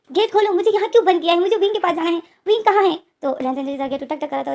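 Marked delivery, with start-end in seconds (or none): 0.1-3.0 high, loud desperate retold scream. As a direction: pound on the door and shout. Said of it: "उस चीखे जा रहा था". (बंद कमरे से लेनजेन चीखकर विंग को पुकारता है)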